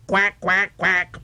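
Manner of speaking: high, quick duck quacks; cow quacking. Acted. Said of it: "She said".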